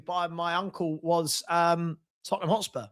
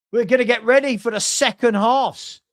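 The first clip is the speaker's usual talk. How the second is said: raised, punchy call to viewers; loud announcement; 3 semitones higher, 5 dB louder than usual. Real, not acted. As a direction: announce it loudly, rallying the viewers. Announcing the second half to viewers.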